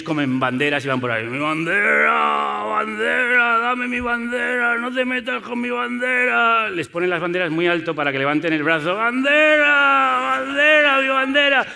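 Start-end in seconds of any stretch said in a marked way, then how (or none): 1.2-6.7 lamento de zombi agudo y arrastrado; zombi obsesionado. Acted. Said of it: "Son como zombies". 9.0-11.7 grito de zombi muy agudo y estirado; aúlla cada palabra alargando las vocales; zombi obsesionado. Acted. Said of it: "Son como zombies".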